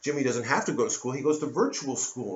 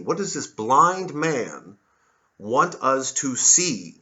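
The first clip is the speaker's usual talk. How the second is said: each word drawn out, louder; lecturing on Milton, slow and weighty; slow emphasis; 5 dB louder, much slower than usual. Real, not acted. Asking what Milton wants us to see, slow and louder. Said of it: "emphatic slow rhetorical question".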